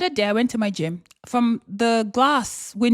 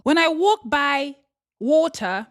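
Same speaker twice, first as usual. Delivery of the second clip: ordinary talk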